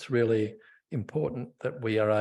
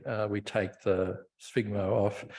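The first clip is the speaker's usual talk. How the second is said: ordinary talk, nothing marked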